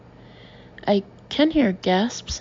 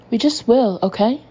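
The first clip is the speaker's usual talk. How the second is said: louder, stung insistence; defensive outburst; 3 semitones higher, 6 dB louder than usual. A narrator's reading. Samantha to journalists, defensive and louder. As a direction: push back at the press, rattled.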